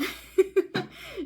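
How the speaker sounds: loud, high giggle; nervous laugh. Real, not acted. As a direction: giggle at your own reveal. After revealing the partner.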